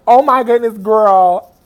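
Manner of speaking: loud, mock-screamed exclamation; retold fan screaming. Fan as singer signs the CD cover, loud starstruck exclamation. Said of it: "like, screaming".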